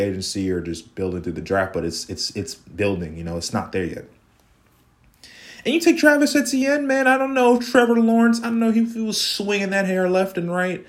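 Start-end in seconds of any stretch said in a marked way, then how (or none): 5.6-9.9 incredulous rant. Real, not acted. Ranting about the pick.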